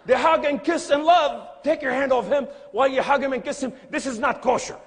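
tollé scandalisé, fort et aigu; indignation rejouée. Retold or imitated. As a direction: protester haut et fort, en outré de comédie. Mock outrage at their hugging.

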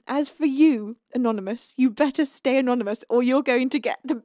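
ordinary talk, nothing marked

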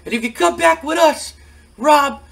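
high-pitched, loud mocking manager voice; Bill Alfonso taunting. Bill Alfonso taunting Rob Van Dam to rejoin, high loud mocking voice. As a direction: sneer at him from way up high, like a whiny heckling manager. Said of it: "And he goes".

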